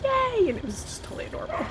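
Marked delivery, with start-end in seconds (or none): 0.0-0.5 put-on very high, childlike cheer; little girl cheering. Little girl cheering for a stranger's fixed car, childlike delight. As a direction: imitate a small child's delighted squeal. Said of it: "the little girl was like".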